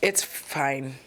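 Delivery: ordinary talk, nothing marked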